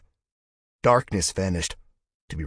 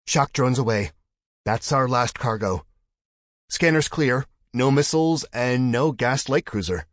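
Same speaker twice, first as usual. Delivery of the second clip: higher, louder urgent report; excited report; 6 semitones higher, 6 dB louder than usual. Acted. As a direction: call it out fast and tense. Officer reports, excited and loud.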